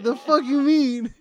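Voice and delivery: loud, high, drawn-out mock outburst; incredulous mom. Repeating Mom's reaction to the uncle, loud mock disbelief.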